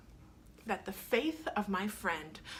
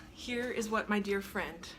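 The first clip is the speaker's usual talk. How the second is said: normal talking voice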